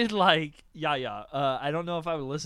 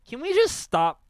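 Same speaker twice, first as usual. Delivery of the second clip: exasperated; 10 semitones higher, 8 dB louder than usual. Real, not acted. Exasperated plea about names.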